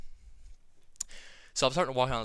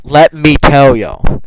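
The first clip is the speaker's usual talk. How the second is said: much louder and very slow, drawn out; big hyped shout; 3 semitones higher, 23 dB louder, much slower than usual. Real, not acted. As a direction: belt it out like a hype man. Repeats it even bigger.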